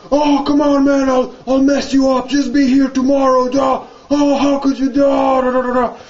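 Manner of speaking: high-pitched imitation of a yelling sore loser; mock screaming. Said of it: "all the time he's screaming".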